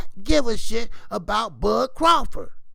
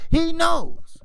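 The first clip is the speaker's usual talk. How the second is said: emphatic; 9 semitones higher, 6 dB louder than usual. Real, not acted. Emphatic louder repeat.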